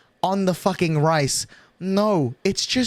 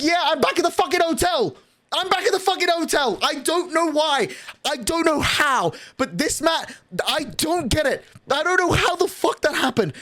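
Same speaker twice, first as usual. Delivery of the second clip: high-pitched, exasperated rant; yelling; 9 semitones higher than usual. Real, not acted. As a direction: yell it in total disbelief. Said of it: "I'm yelling at twelve fifty-three".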